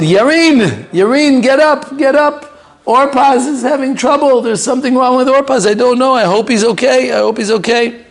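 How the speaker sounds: very high, urgent, calling her awake; panicked mother. Retold or imitated. Mother waking her daughter Yarin in a high panic. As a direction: wake your daughter in alarm.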